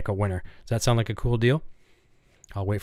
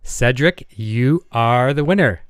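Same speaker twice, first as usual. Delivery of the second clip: hyped giveaway announcement; 5 dB louder than usual. Real, not acted. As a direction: build up the suspense, then crow the winner. Spinning wheel, announcing contest winner.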